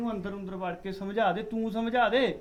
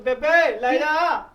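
put-on loud drunken call for mother; sloppy shouting drunk; drunk yell; 4 semitones higher, 9 dB louder, much slower than usual. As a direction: bellow for his mother to take her away. Drunk son yells for mother.

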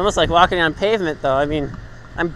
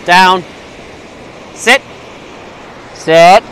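dog training commands; much slower than usual. Real, not acted. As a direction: drill the dog firmly.

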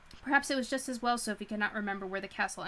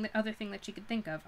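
plain reading voice

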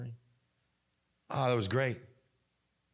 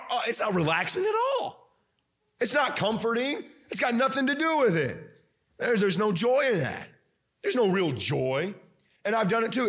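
preaching at full force; 7 semitones higher than usual. Real, not acted. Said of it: "preaching, worked up".

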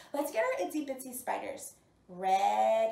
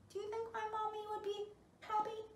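little bee voice; 7 semitones higher, 10 dB quieter, faster than usual. Acted. Voicing the baby bumblebee.

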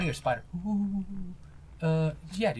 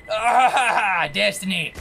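dramatic cry; 6 semitones higher, 11 dB louder, much slower than usual. Acted. Dramatic game moment exclamation.

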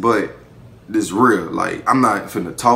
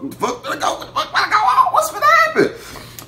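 squeaky high panicked babble with a laugh; hammed-up panic, rising to a squeal; mock panic. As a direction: climb up into a laughing freak-out. Panicking as she drives off.

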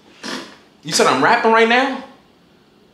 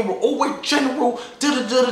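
normal talking voice

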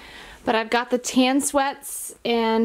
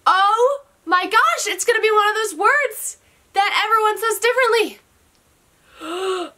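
thrilled realisation; amazed excitement; 11 semitones higher, 4 dB louder than usual. Real, not acted. Realising word's origin.

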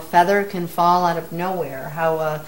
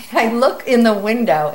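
usual speaking voice

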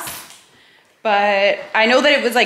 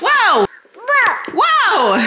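high, loud playful wow; excited wow; 11 semitones higher, 5 dB louder than usual. Real, not acted. Mock amazement for child.

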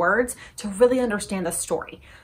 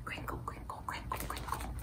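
soft whispered wrapper sound; whispered crinkle; 20 dB quieter than usual. Acted. Librarian making the gum wrapper sound, whispered.

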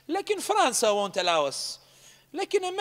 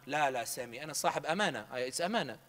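villager's low reply; 6 semitones lower, 5 dB quieter than usual. Retold or imitated. Villager refusing, quoted.

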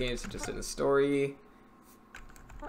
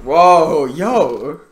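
loud, high, scared whoa; startled yell; 6 semitones higher, 15 dB louder than usual. Real, not acted. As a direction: jump at the screen and yell. Startled by horror game.

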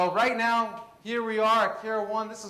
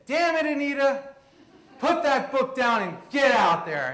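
mock scolding shout; 6 semitones higher, 5 dB louder than usual. Retold self-pep talk, scolding.

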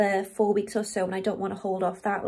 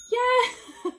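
excited cheer; 14 semitones higher, 4 dB louder than usual. Real, not acted. Cheer at sale going live.

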